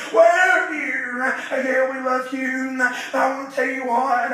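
ordinary delivery

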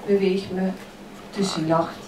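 ordinary talk, nothing marked

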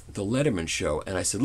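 ordinary talk

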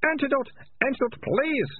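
very high, desperate plea; pitiful high-pitched begging; moaning. A narrator's reading. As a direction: beg for it in a pained wail. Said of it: "moaned the Ratcatcher Two".

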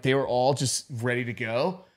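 usual speaking voice

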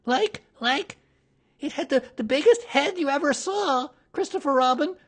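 high, loud, stammering panic; tiny animal gabbling in fright; flustered Piglet. A narrator's reading. Panicked Piglet describes the monster.

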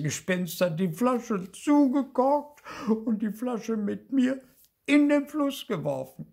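high, loud tearful wail; hammed-up bawling little ghost; sobbing. A narrator's reading. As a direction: sob the grievance out. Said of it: "begann noch lauter zu schluchzen".

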